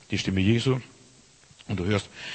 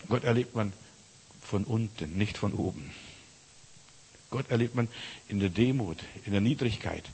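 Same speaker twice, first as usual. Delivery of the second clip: softer, hushed; 3 semitones lower, 8 dB quieter than usual. Real, not acted. Preacher drops to humility theme.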